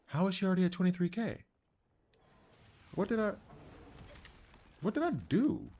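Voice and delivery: what did I just do, real bewilderment; baffled disbelief. Real, not acted. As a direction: pitch jumps way up in stunned confusion. Confused at sudden damage jump.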